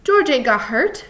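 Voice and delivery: high, loud denial; crying out. A narrator's reading. Lenny refusing to believe George is hurt, crying out. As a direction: deny it loudly, pitch up. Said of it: "he cried".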